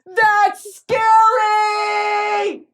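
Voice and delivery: high, very slow drawn-out wail; repeated mock alarm. Real, not acted.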